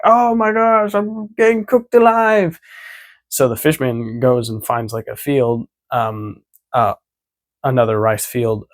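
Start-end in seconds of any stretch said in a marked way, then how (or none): 0.0-2.6 much higher and louder cartoon panic; hammed-up yelp of a burning villain; squealing mock distress; mock agony. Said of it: "He's like".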